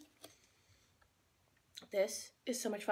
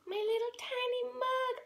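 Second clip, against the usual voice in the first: cutesy high voice; 14 semitones higher than usual. Acted. Cooing over cute mug.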